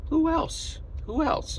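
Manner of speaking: quizzing the audience playfully; eager rhetorical question. Real, not acted. Vlogger teasing viewers to guess who else, pitched high.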